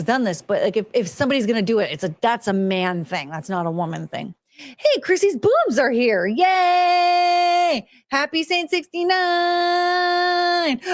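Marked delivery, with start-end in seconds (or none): 4.5-10.8 high, slow, put-on cheering voice. Streamer cheers a viewer's arrival, high and drawn out.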